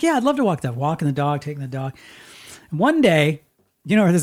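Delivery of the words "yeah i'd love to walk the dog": put-on much higher, eager little girl; light mock of a delighted child; eager kid. Little sister eagerly accepting the walk, high mock voice. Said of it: "So she's like".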